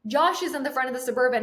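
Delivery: ordinary delivery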